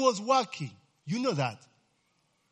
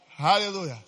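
ordinary talk, nothing marked

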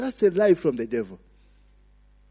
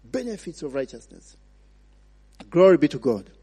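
ordinary talk

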